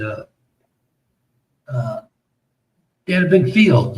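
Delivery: normal talking voice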